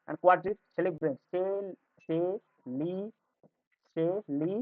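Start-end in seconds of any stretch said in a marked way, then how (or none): 1.3-4.5 সে and লি as separate syllables with long gaps; teacher's slow syllable count on সে, লি; syllable division. Shown as a demonstration. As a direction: sound out সে, লি one syllable at a time. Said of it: "syllable division নিয়ে আলোচনা".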